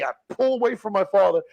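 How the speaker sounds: ordinary talk, nothing marked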